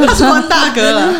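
loud, high, laughing words. Real, not acted. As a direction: say it while cracking up. Laughing through reply. (边笑边说)

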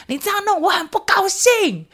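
put-on very high child voice; imitating her son. Son practicing a polite anger phrase, high childish voice. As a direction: say it high and pouty like a small boy. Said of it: "我就鼓励他可以讲出他认为代表生气啊".

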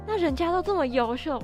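normal talking voice

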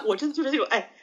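normal talking voice

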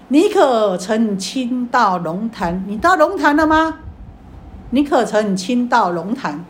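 slow master's question. Retold or imitated. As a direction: drag each word like a Zen master testing him. Master answers from behind screen. (缓慢有力地反问)

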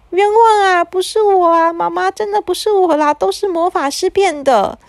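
put-on high whiny kid pleading innocence; protesting child. A narrator's reading. Boy pleads innocence to his mother, high whining, slowing at the end. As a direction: wail it like a boy wrongly blamed.

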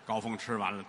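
ordinary talk

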